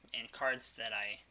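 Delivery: ordinary delivery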